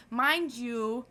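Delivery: usual speaking voice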